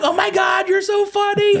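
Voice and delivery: very high-pitched mock female gush; gushing imitation. Celebrity praising the friend's joke, high gushing. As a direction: squeal it in delighted imitation. Imitates actress laughing at comment.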